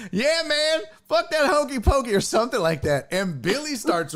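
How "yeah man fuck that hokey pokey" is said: high-pitched imitated heckler; heckling back. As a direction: call back at the stage in mock indignation. Heckler shouts back at stage.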